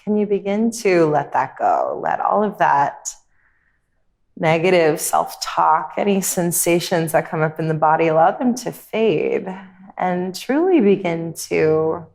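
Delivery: calm, unhurried meditation voice with long pauses; guided meditation. Real, not acted. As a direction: guide them gently inward, leaving space between phrases.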